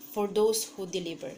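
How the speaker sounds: ordinary delivery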